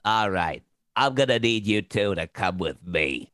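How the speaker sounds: a hulking goon who talks low; soft-spoken hulking thug. Shown as a demonstration. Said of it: "this big thunderous guy steps into the room and goes".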